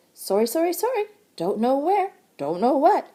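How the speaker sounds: a fidgety squirrel apologizing fast; chattering squirrel. A narrator's reading. Squirrel apologizing to Jessie, high and jittery. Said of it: "chattered the squirrel".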